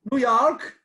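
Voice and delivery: lightly faked American, high and loud; mock New York. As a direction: blurt it out as a fake Yank. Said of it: "Marty looks at us and just goes".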